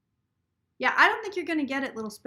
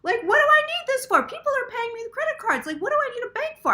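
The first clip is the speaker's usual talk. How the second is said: much higher and louder, fast retold disbelief; incredulous rant; 11 semitones higher, 6 dB louder than usual. Telling viewers how she answered the bank, high incredulous. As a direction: throw it back at them, baffled. Indignant retelling of a bank call.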